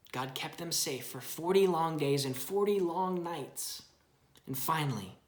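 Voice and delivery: storybook narration for a little one; reading to a child. Acted. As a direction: read it gently to your child. Parent reads Bible story aloud.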